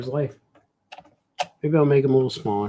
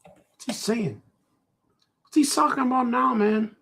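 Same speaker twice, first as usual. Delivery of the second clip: higher, puzzled mock listener; confused viewer voice; 9 semitones higher than usual. Mocking viewers who can't follow, puzzled. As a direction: play the baffled viewer.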